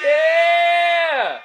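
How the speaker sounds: big hype yell to open the show; hype shout. Real, not acted. Host hyping up his audience with a loud yell. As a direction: shout it out to get the hype going. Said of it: "let's get the hype".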